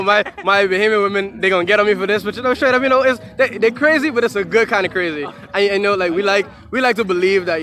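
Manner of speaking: fired-up, teasing praise; hyped up. Real, not acted. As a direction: build it up, playful and excited. Hyped teasing about Bahamian women.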